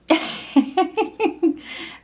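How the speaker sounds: high, breathy burst of laughter. Real, not acted. Reader amused after announcing the open door, laughing. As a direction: laugh out in a high, airy run.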